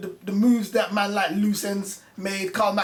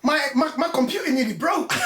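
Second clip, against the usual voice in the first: high, incredulous, breaking into laughter; real amusement bubbling through; laughing disbelief; 7 semitones higher, 4 dB louder than usual. Real, not acted. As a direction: splutter it out, about to crack up. Laughing at an absurd claim.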